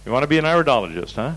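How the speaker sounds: normal talking voice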